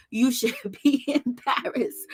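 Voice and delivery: laughing. Real, not acted.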